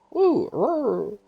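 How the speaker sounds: thin, high little roar; small wounded saurian call; high weak creature cry. Acted. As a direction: whimper it like a hurt beast. Saurian cries weakly.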